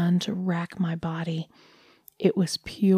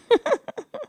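high delighted laugh. Acted. Dog lady laughs.

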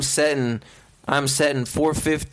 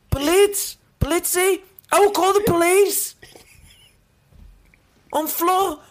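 mock British grocer, pitched well up and louder; British shopkeeper; 12 semitones higher, 4 dB louder than usual. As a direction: threaten the shoplifter in a goofy British voice. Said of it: "a British man that works at a grocery store".

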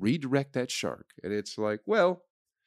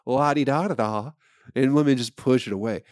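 mocking carefree voice; 4 semitones higher, 6 dB louder than usual. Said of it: "you're like".

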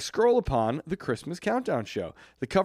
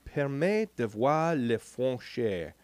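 reading French; 4 dB quieter, slower than usual. Real, not acted. Reading French label slowly.

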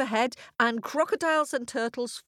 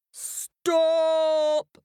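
louder, higher commanding roar; roaring lion; 8 semitones higher, 5 dB louder than usual. A narrator's reading. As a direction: bellow to halt the stampede. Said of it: "he roared".

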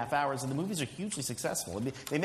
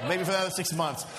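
normal talking voice

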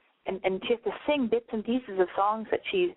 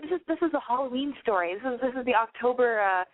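ordinary talk, nothing marked

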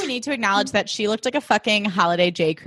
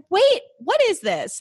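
mock disbelief; 9 semitones higher, slower than usual. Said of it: "And I'm like".

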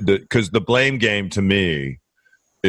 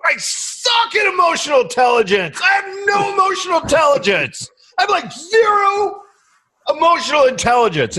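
mock angry rant. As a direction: rant at himself like an outraged cartoon. Mock self-rant about emotional intelligence.

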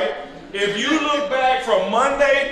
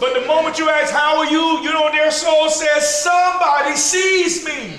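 pitched-up preaching, swelling to a shout on the last line; rising preaching; 7 semitones higher than usual. Acted. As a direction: preach it, climbing toward the cry. Preacher building to climax.

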